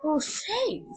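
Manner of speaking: usual speaking voice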